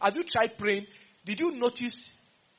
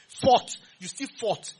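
stretched slow, louder fought; slow emphasis; 3 semitones higher, 5 dB louder, much slower than usual. Real, not acted. Preacher hammers on fighting in the boardroom, slow. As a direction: lean on fought, slowly.

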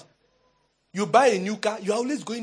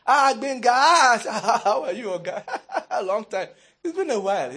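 effusive, laughing greeting; big smile; 3 semitones higher than usual. Retold or imitated. Employee greeting branch manager at filling station, overdone smiling cheer. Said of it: "With a big smile".

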